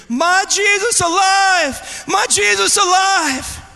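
slow, high, full shout; drawn-out high childlike scream; imitated child screaming. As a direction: mimic a boy yelling joyfully for all to hear. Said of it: "he started screaming".

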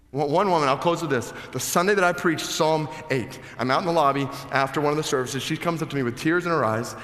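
high, loud preaching at full force. Acted. Pastor presses the congregation, preaching at full force. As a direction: drive the point home to the room.

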